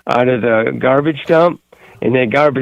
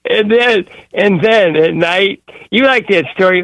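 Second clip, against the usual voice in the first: excited; 8 semitones higher than usual. Real, not acted. Caller excited, pushing on.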